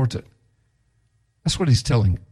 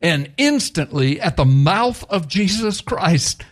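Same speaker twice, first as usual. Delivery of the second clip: ordinary talk, nothing marked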